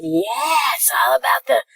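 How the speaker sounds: mock hype. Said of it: "I would just been like".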